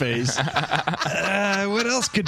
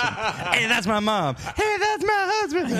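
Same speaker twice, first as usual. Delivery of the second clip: high squeaky that's my husband; mock reactions; 7 semitones higher, slower than usual. Friend acting out everyone's reaction on request, in a high voice. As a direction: voice each character, pitched up. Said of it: "I want you to do the lines".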